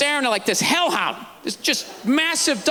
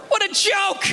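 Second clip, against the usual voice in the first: ordinary talk, nothing marked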